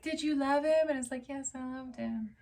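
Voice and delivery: small, soft, tearful retelling; hushed mock-weepy voice, trailing away. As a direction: act out a quiet, heartbroken exchange. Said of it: "So he's sobbing. He's ugly crying".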